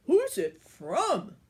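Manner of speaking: a giant dying to know who sent it; eager giant. A narrator's reading. Giant eager about his card.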